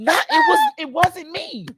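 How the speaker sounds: put-on the boy protesting his innocence; innocent kid. Boy denying again.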